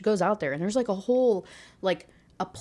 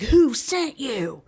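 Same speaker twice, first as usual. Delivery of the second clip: slow, higher acted demand; mock aggressive yell; 5 semitones higher, 4 dB louder, much slower than usual. Alien accusing her of being a spy, mock aggressive yell. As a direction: imitate an angry interrogator, each word stretched. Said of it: "being aggressive and rude and screaming in my face".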